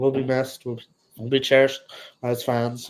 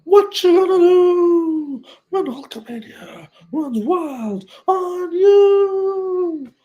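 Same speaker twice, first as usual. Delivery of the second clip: much higher, louder, slow drawn-out wrestler promo voice; wrestling promo catchphrase; 6 dB louder, much slower than usual. Retold or imitated. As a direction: ham it up like a wrestler cutting a promo.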